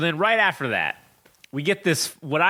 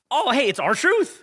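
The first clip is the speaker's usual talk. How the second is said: slow, higher cheerful announcer tone; mock surprise entrance; R-Truth impression; 7 semitones higher, slower than usual. Friend imitating R-Truth's cheerful entrance. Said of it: "And he's like".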